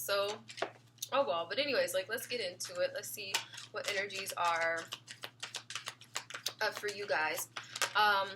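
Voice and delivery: ordinary talk, nothing marked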